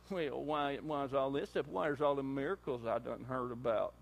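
lightly voiced whiny complaint; griping Gideon. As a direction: grumble back like a sulky farmhand. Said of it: "the first thing you wanna do to me is gripe".

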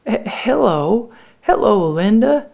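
high, halting, tentative hello; hesitant greeting. Acted. Coming out of the wood to greet Linda, hesitant.

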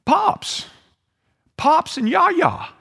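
put-on high-pitched excited little-kid call; [name]. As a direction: call out like a small child spotting grandparents. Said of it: "He's going".

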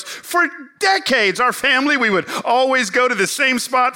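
mildly imitating an upset churchgoer; peeved older lady. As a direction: lightly voice her grumbling complaint. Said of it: "she's ticked off, and she's peeved".

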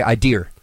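"I dear" for idea, ending in R; added R. Retold or imitated. Quoting grandmother saying idea, "I dear" with added R. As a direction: end "I dear" on a hard R. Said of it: "putting it on a word where it doesn't belong".

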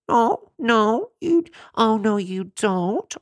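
very high, slow no, you don't; mock alarm. Real, not acted. Warning self-injectors about arteries, high and slow. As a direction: gasp like it's unthinkable.

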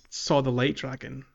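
ordinary talk

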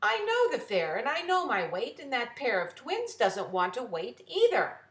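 high, loud indignant outburst; indignant girl. Acted. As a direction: protest loudly with pitch up. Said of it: "said Mary".

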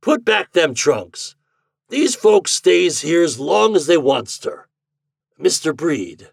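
put-on a rough old farmer giving orders; rough country landlord. A narrator's reading. Jacobus telling Pete to keep the guests, rustic gruff order.

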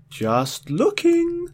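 high, slow wavering warble; drawn-out high warble; troubling warble. Acted. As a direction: let the voice wobble up high. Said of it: "he warbled troublingly".

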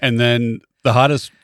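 ordinary talk, nothing marked

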